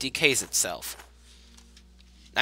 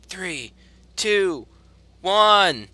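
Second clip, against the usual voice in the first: excited countdown; 7 semitones higher, 4 dB louder than usual. Pretend. Mock New Year countdown.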